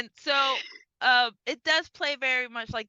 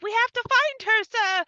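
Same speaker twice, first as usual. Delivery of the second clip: mock dutiful maid; 11 semitones higher, 3 dB louder than usual.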